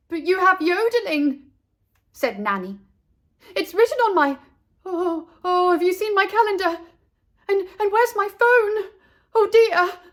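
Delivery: high, loud, frazzled nanny voice; a fussy nanny hunting for her things; flustered nanny. A narrator's reading. Nanny panics about lessons and lost calendar, high frazzled voice.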